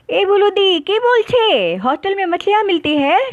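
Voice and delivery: high-pitched, incredulous exclamation; astonished protest. A narrator's reading. Astonished friend questions fish claim. (हैरानी से टोकते हुए)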